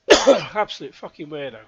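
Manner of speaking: exasperated loud insult; loud outburst. Real, not acted. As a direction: spit it out, disgusted. Insulting his stalker.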